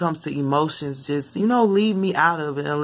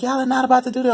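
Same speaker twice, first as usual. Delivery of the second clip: louder and higher, emotions put in; heated scolding; 8 semitones higher, 4 dB louder, faster than usual. Retold or imitated. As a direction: scold the kids with feeling. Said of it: "when I was young, you know, I do stuff like that".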